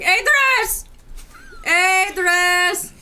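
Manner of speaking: shouting a name across the hall; calling out. Pretend. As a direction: call out loudly, searching. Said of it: "roam around the academy going".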